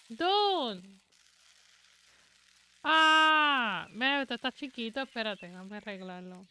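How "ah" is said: short loud excited "ah"; excited gasp of delight. Real, not acted. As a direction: burst out with delight. Reacting to stream.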